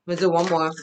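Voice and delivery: choking a little, throat tight; throat catching. Real, not acted. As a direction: speak through a catch in the throat. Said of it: "I'm choking all of a sudden".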